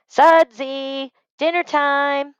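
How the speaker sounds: high, loud call to dinner. Acted. Mother calling her son to dinner, loud and high. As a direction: call him in from across the house.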